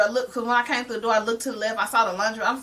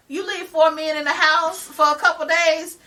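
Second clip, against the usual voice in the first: ordinary delivery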